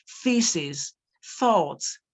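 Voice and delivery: Feces and thoughts said with tongue between the teeth; th sound demo. Shown as a demonstration. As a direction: stress the th in thoughts, tongue forward. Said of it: "the sound you have in teeth".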